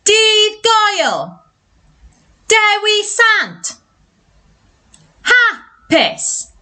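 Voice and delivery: high, drawn-out shout; super loud voice. Shown as a demonstration. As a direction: shout it out for the class to copy. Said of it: "say it in a super loud voice".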